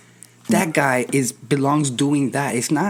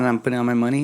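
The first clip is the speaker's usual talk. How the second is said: ordinary talk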